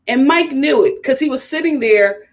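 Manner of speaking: ordinary talk, nothing marked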